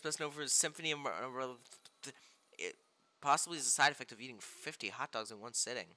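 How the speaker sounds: ordinary talk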